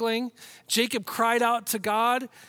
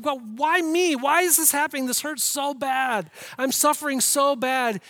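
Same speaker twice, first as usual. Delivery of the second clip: higher, louder anguished plea; crying out; 5 semitones higher, 6 dB louder, slower than usual. Acted. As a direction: cry out to God in suffering. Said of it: "when you're crying out".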